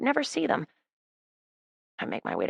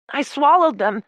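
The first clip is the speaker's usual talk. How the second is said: desperate; 8 semitones higher, 6 dB louder than usual. Acted. Desperate confession.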